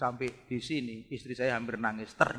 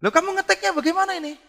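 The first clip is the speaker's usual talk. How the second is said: much higher, louder amazed asking; astonished question; 9 dB louder than usual. Retold or imitated. Pastor retelling his amazement at the student, high and loud. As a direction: act out disbelief.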